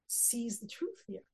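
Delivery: slow, hushed whisper; slow dramatic whisper. Real, not acted. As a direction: breathe it out as a revelation. Shaul's moment of realization.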